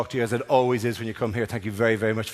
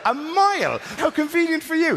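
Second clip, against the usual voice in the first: high, incredulous sarcasm; mock outrage; 13 semitones higher than usual. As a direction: exclaim it in scandalised disbelief.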